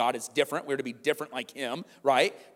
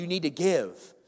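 hammed-up hype preacher voice, a bit higher; mocking prosperity preacher; 3 semitones higher than usual. Mocking a prosperity preacher to the congregation, hyped-up preacher voice. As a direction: parody a money-promising preacher. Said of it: "I'm making fun of that".

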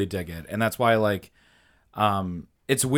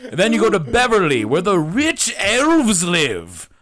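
game master narration; 9 semitones higher, 5 dB louder than usual. Pretend. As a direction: set the scene like a DM, giggling.

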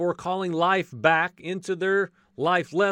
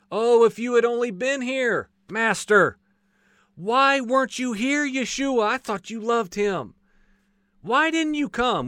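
high, louder anguished plea; retold grieving cry, pitched high; pleading lament; 6 semitones higher, 3 dB louder than usual. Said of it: "Everyone looked back, cried out".

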